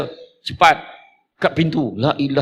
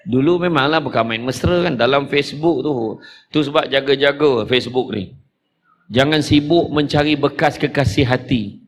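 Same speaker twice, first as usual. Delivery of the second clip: normal talking voice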